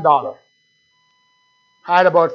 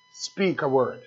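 hushed; 6 semitones lower, 8 dB quieter, slower than usual. Real, not acted. Hushed emphasis on God's word.

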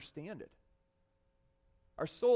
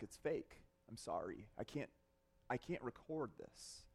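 much softer, trailing away into a near-murmur; a broken apology that sinks lower and quieter; fading confession; 7 semitones lower, 8 dB quieter than usual. Retold or imitated. Confessing to film guy, fading.

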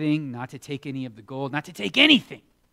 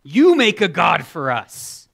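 mock pushy friends; pressuring friends; 4 semitones higher, 10 dB louder than usual. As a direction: push him to make a god. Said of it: "His friends came to him and said".

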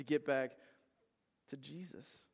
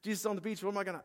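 higher-pitched, excited retelling; flustered excitement; 6 semitones higher, faster than usual. Retold or imitated. Peter on seeing Jesus on the beach, flustered and excited. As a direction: act out getting all worked up. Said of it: "so flustered".

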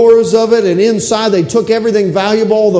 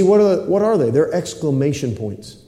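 ordinary talk, nothing marked

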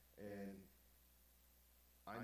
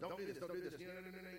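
higher, rattled-off mock scolding; nagging parent; 8 semitones higher, faster than usual. Preacher mimicking parents who never let children answer, nagging. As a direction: imitate a parent piling on the don'ts.

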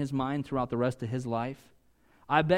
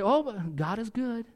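mock pious platitude; 6 semitones higher than usual. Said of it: "they say".

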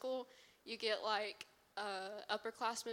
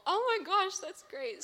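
excited; 9 semitones higher, 9 dB louder than usual. Retold or imitated. Own excited reply quoted.

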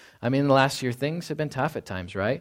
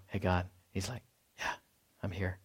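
soft imagined dialogue; 4 semitones lower, 10 dB quieter than usual. Retold or imitated. Acting out God answering.

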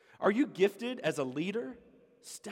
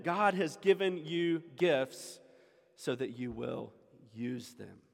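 ordinary talk

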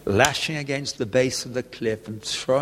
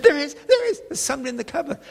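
put-on scared little girl; faster than usual. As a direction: insist like a frightened little girl. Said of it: "She said".

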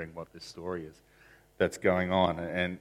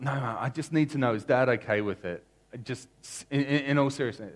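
higher, louder, pleading; pleading son; 7 semitones higher, 8 dB louder than usual. Retold or imitated. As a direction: son begging his mom for the truth, loud and high. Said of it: "And he's like".

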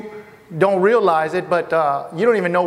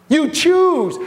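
raised emphasis; 7 semitones higher than usual. Real, not acted. Preacher stresses choose.

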